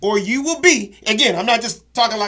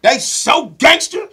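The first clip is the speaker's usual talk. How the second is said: slow emphatic; 6 semitones higher, much slower than usual. Real, not acted.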